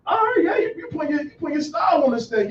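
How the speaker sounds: retelling his reaction to the coach's new style; excited praise. Retold or imitated. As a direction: cheer him on like a fan. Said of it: "I'm like".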